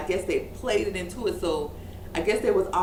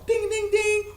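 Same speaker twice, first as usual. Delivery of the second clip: high ringing ding-ding-ding; imitated boxing bell; 12 semitones higher, 3 dB louder than usual. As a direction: sing out the bell that starts a fight. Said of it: "then the bell rung".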